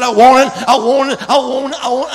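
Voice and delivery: loud desperate plea; loud pleading. Retold or imitated. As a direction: cry out for it. Pastor begging for the Spirit.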